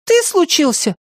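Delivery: very high, squeaky retort; outraged small creature; indignant. A narrator's reading. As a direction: snap back at a friend in a high voice. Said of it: "возмущённо повернулся к нему Мартын".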